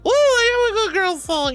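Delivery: squealing with delight at the song; excited squeal. Real, not acted. Excited about a redeem song.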